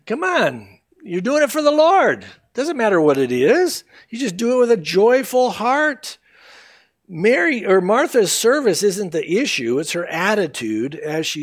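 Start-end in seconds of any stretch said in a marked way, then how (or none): 0.0-6.2 incredulous scolding. Retold or imitated. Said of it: "it's like".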